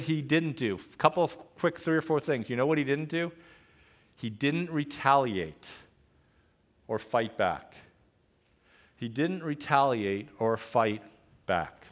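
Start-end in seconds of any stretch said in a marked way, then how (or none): none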